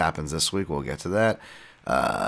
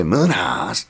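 put-on loud, pitched-up Bisping accent on the name; 3 semitones higher, 5 dB louder than usual. Podcaster admitting his verbal habit, annoying Bisping accent. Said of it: "In, like, an annoying Bisping accent".

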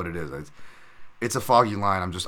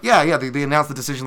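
louder, higher, excited confirming; excited reply; 6 semitones higher, 8 dB louder, faster than usual. Retold or imitated. Coach telling the fighter he won, louder excited reply. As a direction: tell him eagerly. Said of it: "I'm like".

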